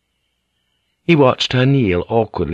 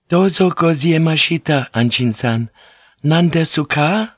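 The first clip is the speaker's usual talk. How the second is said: polite Japanese woman, pitched high; thin, high voice; 7 semitones higher than usual. A narrator's reading. Fujiko greets Anjin-san, thin high voice. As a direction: speak high and courteous, lifting the question. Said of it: "in her thin, high voice".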